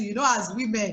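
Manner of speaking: ordinary talk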